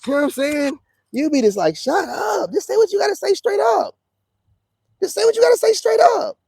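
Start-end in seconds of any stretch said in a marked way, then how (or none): 1.8-3.9 much higher, fed up; a high, fed-up outburst; exasperated retort. Retold or imitated. Exasperated retort to passive aggression. 5.0-6.4 higher still and louder; a high, loud fed-up demand; louder exasperated repeat. Retold or imitated.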